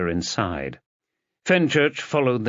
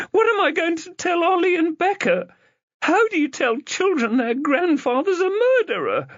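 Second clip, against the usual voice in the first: lightly voiced sister, higher and upset; a hint of a panicking sister, pitched up; distraught sister. A narrator's reading.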